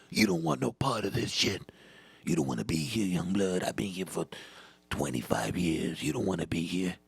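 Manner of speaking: low, weary veteran voice; old-timer. Acted. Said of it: "He's just an old timer".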